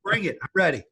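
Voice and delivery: mock squaring up for a fight; fired-up challenge. Daring runaway partner Kevin to face him, fired up. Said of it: "Well, I'm pissed".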